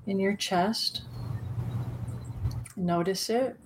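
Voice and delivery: calm guided meditation voice. Acted. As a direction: guide them gently into the present.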